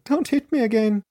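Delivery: lightly acted tearful plea, high; acted pleading. A narrator's reading. Narrator voices victim begging attacker, acted high pleading. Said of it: "pleading and sobbing".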